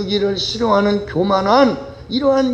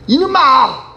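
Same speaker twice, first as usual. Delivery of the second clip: tyrant shouting; 13 semitones higher than usual. Acted. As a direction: scream at the servant for forgetting the snacks. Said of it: "소리를".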